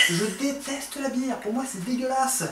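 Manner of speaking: disgusted. Real, not acted. Disgusted by the beer.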